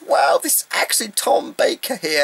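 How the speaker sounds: high, excited retold awe in the queue; starstruck. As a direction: gasp it like a starstruck fan. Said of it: "I was like".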